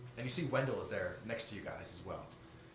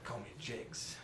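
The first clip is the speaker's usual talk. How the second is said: menacing gangster; 5 semitones higher, 10 dB louder than usual. Acted. NPC menacing the party.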